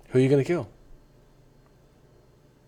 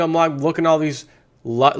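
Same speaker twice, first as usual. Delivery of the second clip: usual speaking voice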